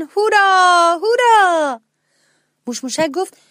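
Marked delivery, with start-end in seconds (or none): 0.0-1.8 loud, high, jubilant cheer; cheering hooray. A narrator's reading. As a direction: cry out in pure joy. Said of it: "داد زدن".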